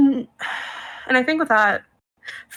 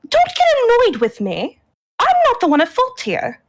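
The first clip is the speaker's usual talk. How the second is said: high-pitched, indignant protest; defensive protest; 8 semitones higher than usual. Acted. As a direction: push the blame back, pitched high. Siblings quarrel in character.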